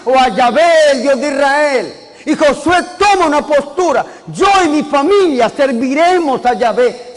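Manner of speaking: fervent pulpit preaching; preaching at full force. Acted. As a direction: preach it to the congregation with fervor. Preacher raises his sermon.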